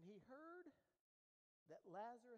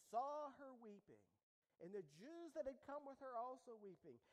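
raised, loud preacher reading verse; forceful scripture reading; 4 semitones higher, 8 dB louder than usual. Acted. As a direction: proclaim the passage to the room. Reads scripture aloud at force.

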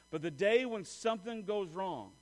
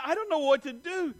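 high, loud helpless whine; hammed-up helpless complaint; mock near-tears; 7 semitones higher, 5 dB louder than usual. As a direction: act as if about to cry. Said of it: "I'm about to cry".

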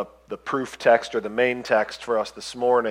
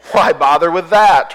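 louder, higher careless grasshopper; carefree chirpy grasshopper; 4 semitones higher, 7 dB louder than usual. Acted. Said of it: "replied the grasshopper".

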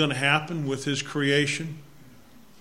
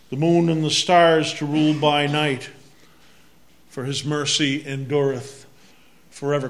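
solemn Bible reading aloud; scripture reading; 5 dB louder than usual. Acted. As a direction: read the psalm with weight. Responsive Psalm reading.

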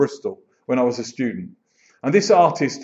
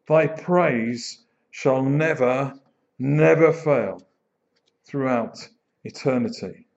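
slow recitation; slower than usual. Shown as a demonstration. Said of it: "reciting the hymn slowly".

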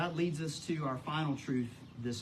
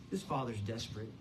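ordinary delivery

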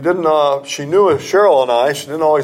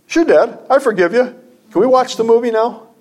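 put-on higher, quick and carefree like a little boy; young son's voice; 10 semitones higher than usual. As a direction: toss it off brightly, a kid eager to get to the movie. Father voices young son's reply.

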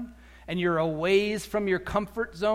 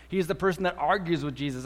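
usual speaking voice

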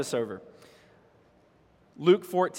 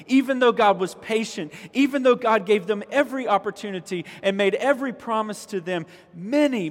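loud, higher-pitched, insistent preaching; impassioned preaching; 5 semitones higher, 5 dB louder than usual. Real, not acted. Preacher tells the church how God was patient, impassioned preaching. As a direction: pile up each clause with pulpit force.

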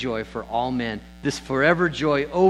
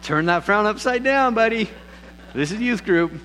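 teasing wife; 8 semitones higher than usual. Retold or imitated. Wife in back row teasing the sad pastor, high playful.

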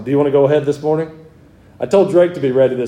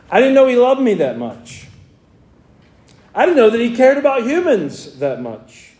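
raised, ringing preacher's voice, pitched well up; fervent preaching; 9 semitones higher than usual. Retold or imitated. Pastor preaching to his church, raised and fervent. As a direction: preach it out with full conviction to the congregation. Retold moment of being saved.

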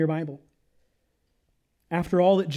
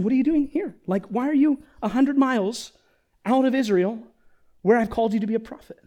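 higher-pitched, puzzled questioning without the shouting; simply asking God; 6 semitones higher, 4 dB louder than usual. Acted. God simply asking Elijah why he left Israel, questioning. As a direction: ask it simply, honestly wondering. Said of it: "he could just be simply asking".

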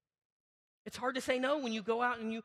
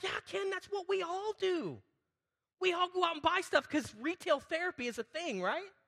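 knowing reply; 9 semitones higher than usual. Retold or imitated. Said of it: "And I'm like".

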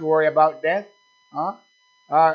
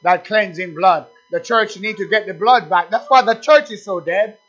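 loud, raised praise, leaning on key words; fervent preaching; 3 semitones higher, 6 dB louder than usual. Real, not acted. Preacher extolling the blood to the church, fervent exhortation. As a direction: exalt it with forceful, emphatic cries.

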